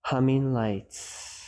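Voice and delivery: name each sound slowly and evenly; slow, quiet mindful labeling; calm noticing. Shown as a demonstration. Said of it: "calm and centered with it".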